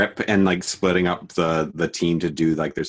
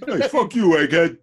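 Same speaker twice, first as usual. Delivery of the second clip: mock offended mechanic; 10 semitones higher, 7 dB louder than usual. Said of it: "Every mechanic watching the show is like".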